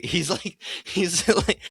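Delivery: real giggly laughter through the words; laughing. Real, not acted. Fan cracking up describing the funny robot, laughing.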